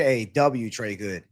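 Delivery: usual speaking voice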